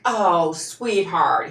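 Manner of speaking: drawn-out, syrupy imitation of a pitying boss; slow, exaggerated patronising coo; mock condescending sympathy. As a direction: mimic fake comfort, stretching the words slowly. Retold boss's patronising consolation.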